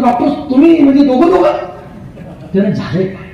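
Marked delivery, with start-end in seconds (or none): 0.0-1.5 high, loud mock shout at the doctor; startled shout. Drunk friend shouts his question at the eye doctor, hammed-up high yell. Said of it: "हा ओरडतो".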